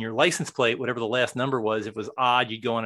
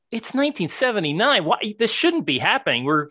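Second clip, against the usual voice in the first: higher-pitched, incredulous protest; indignant disbelief; 9 semitones higher, 7 dB louder than usual. Acted. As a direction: voice the everyman's outraged disbelief. Voicing what people said back then.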